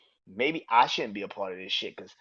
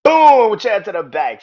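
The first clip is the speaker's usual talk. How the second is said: "Boom!" yelled much higher and louder, then "Chair to the back" called out; big shouted "Boom!"; 9 semitones higher, 15 dB louder, slower than usual. Retold or imitated. Reliving the chair shot for listeners, roaring "Boom!". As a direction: belt out "Boom!" like a ringside crash.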